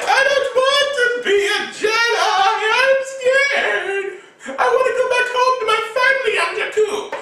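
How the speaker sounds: very high, half-laughing whimper; mock scared high voice; scared Rey. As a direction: whine it out like a frightened girl.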